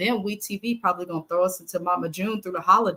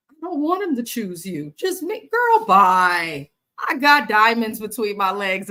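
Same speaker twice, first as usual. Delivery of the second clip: sassy; 3 semitones higher, 5 dB louder than usual. Retold or imitated. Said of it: "my mother-in-law would fix herself to say".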